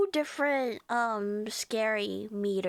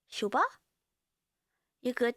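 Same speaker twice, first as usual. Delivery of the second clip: ordinary delivery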